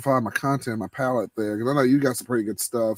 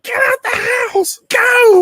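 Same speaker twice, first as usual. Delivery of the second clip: high shrill-free yelled order, much higher and louder; mock daughter yell; 8 dB louder, slower than usual. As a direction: yell him out of the house like the daughter. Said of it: "even my daughter was like".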